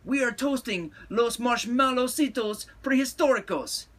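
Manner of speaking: high loud Chihuahua voices; hollered. A narrator's reading. Chihuahuas announce their toasting to Skipito, hollering. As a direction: holler it out, pitch way up. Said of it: "hollered the Chihuahuas".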